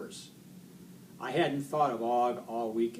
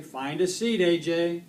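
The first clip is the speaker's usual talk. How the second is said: raised, higher voice; driver's order; 6 semitones higher, 8 dB louder than usual. Acted. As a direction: call an order down the bus. Bus driver ordering a boy.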